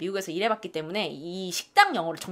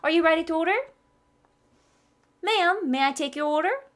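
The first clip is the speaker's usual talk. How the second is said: higher, slow waiter questions; waiter lines read; 7 semitones higher, much slower than usual. Acted. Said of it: "두 문장 한번 읽어드릴게요". (웨이터처럼 읽어주듯)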